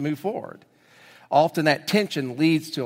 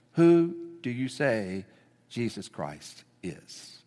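slow, much softer, falling almost to a hush; quiet searching question; 5 semitones lower, 5 dB quieter, slower than usual. Real, not acted. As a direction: ask it gently into the silence. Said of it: "And I ask you the same question".

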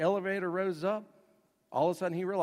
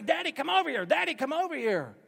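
put-on much higher, childlike calling; kids calling daddy; 12 semitones higher, 5 dB louder than usual. The kids call their scared father onto the glass, lightly childlike. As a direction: playfully call dad over like a child.

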